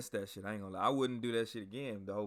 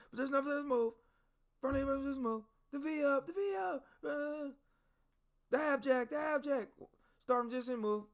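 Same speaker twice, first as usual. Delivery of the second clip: barked drill commands. Retold or imitated. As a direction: drill sergeant barking exercises at recruits. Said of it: "One drill sergeant was like".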